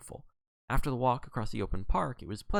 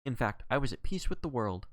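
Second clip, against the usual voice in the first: usual speaking voice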